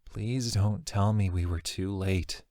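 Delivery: hushed and slow, pleading; quiet plea. Acted. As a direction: beg softly, dreading the answer. Inner plea, fearing too late.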